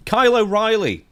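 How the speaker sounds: bright announcer-style name call; announcing a name. Real, not acted. Announcing next topic.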